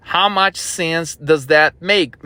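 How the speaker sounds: slow, deliberate delivery; said slowly. Shown as a demonstration. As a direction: drag it out to let it sink in. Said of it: "Let me say it slowly".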